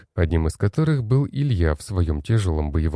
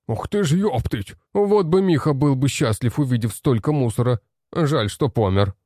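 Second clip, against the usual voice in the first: gnome voice; 6 semitones higher than usual. Acted. Gnome's excited exclamation.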